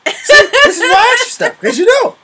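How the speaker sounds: high, loud, laughing tease. Real, not acted. Girlfriend teasing partner about asking questions, laughing.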